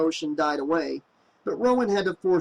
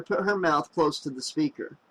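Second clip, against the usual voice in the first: ordinary delivery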